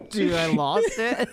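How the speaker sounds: ordinary talk, nothing marked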